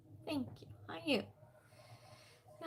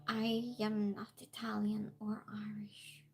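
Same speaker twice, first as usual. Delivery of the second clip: whisper; 3 semitones lower, 7 dB quieter than usual. Real, not acted. Quiet aside denying accent comment.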